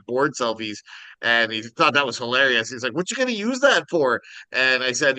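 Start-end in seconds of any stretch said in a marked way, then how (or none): 2.9-4.2 high-pitched curious Minnesotan; imitated Minnesotan, pitched way up; Minnesotan accent. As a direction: ask it in a swooping Minnesota lilt. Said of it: "talked like that. It was hilarious".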